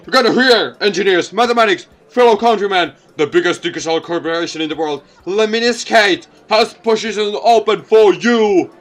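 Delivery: high, loud corporate pitch; recruiting ad. Acted. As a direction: call out to the engineers.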